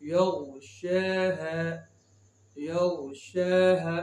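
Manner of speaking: slow, long held शा in यग़शाहा; stretched vowel. Shown as a demonstration. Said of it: "उसी तरह पढ़ेंगे". (यग़शाहा का शा खींच कर)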